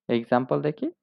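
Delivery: usual speaking voice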